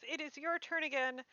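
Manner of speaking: ordinary talk, nothing marked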